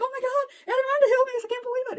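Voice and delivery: high, bubbling excitement in the retold call; thrilled retelling. Telling her friend Jane about the healing, with high thrilled excitement. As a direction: squeal it out like a sudden miracle.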